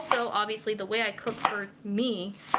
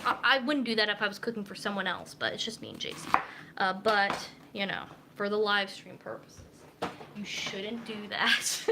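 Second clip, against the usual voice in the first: ordinary talk, nothing marked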